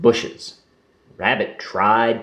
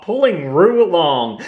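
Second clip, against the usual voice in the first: ordinary delivery, nothing marked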